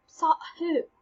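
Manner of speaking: not following at all; confused. Retold or imitated. Hamlet not following Horatio, lightly confused. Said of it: "He goes".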